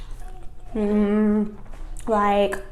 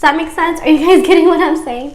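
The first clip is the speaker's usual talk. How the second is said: laughing; 7 semitones higher, 8 dB louder than usual. Real, not acted. Laughing rhetorical check with viewers.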